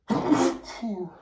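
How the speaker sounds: high loud sneeze. Acted. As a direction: sneeze out hard.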